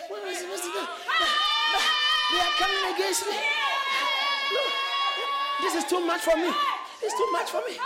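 high, loud mock panic; overwhelmed pleading. Believer facing enemies coming against him, acted desperate cry. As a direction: cry out as if under attack.

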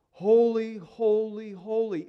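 preached Holy, holy, holy, fading; emphatic triple holy. Shown as a demonstration. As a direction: hammer the first Holy, then let holy, holy come down. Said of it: "that repetition is emphatic".

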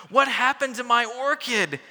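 high, loud cry of shock; shocked dad. Acted. Father sees his wilted orchid, high loud shocked cry. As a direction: gasp it out as the stunned father. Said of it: "a look of shock and sorrow".

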